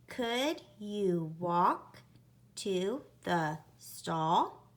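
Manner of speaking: very slow, each word spaced; slow, word-by-word dictation. Shown as a demonstration. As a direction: count the words as you say them slowly. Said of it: "let's say it together".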